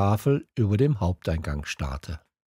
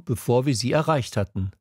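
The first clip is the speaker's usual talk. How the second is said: plain reading voice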